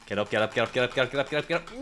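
low start, climbing in pitch; urgent repeated urging. Real, not acted. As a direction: chant it non-stop, rising as the climber struggles.

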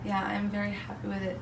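normal talking voice

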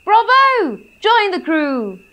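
high, loud cheer; shout. Acted. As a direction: cheer with delight. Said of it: "the other two shouted".

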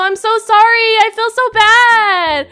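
loud, high, slowly stretched apology; anguished wail. Real, not acted. Apologizing to a trader she declines.

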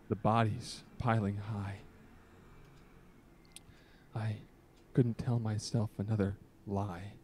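slow, solemn recital with long pauses; earnest poetry reading, lingering between lines; dramatic poem reading. Acted. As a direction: read it like a heartfelt confession poem.

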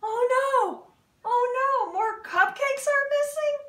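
high, loud alarmed exclamation; squealing oh no over lost cupcakes; alarmed cry. Acted. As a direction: cry out in shock at the theft. Character panics over missing cupcakes.

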